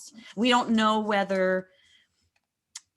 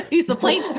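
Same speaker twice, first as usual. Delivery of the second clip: breaking into a laugh, higher and louder; amused self-correction through a laugh; 8 semitones higher, 7 dB louder than usual. Real, not acted. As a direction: laugh off the slip as you fix it. Corrects herself, laughing at mistake.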